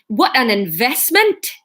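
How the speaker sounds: Newcastle lilt, slowed; Geordie accent. Acted. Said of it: "the Newcastle".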